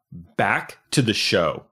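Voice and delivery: slow, clearly spelled-out words; over enunciating. Shown as a demonstration. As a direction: say it slowly for a toddler. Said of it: "we're gonna go one word at a time".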